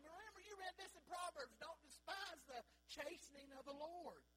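loud, high, rousing preaching to the church; full force preaching. Acted. Pastor rousing his congregation, loud fervent preaching. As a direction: drive it home from the pulpit, near shouting.

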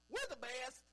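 mock proud church member insisting; hammed-up boast; boastful churchgoer. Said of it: "They're playing".

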